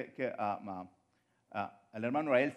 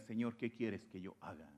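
soft, low and hushed, bowing before the Lord; humble quiet plea; 6 semitones lower, 9 dB quieter than usual. Retold or imitated. Preacher voicing the sinner's humble plea, quietly. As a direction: surrender it quietly, almost under breath. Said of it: "más vale que tú le digas".

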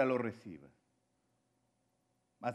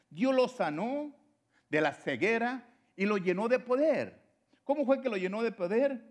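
higher and louder, fervent proclamation; preaching at full force; 8 semitones higher, 5 dB louder than usual. Acted. Preacher proclaiming to the congregation how God healed Saulo, fervently. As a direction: proclaim the healing with fire. Preacher exclaims God's power.

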